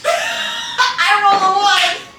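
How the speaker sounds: slow, wailing laugh through the words; laugh-cry over a bad roll; laughing despair. Real, not acted. Player laments rolling a one.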